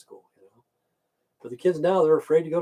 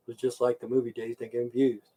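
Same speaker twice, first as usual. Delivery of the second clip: usual speaking voice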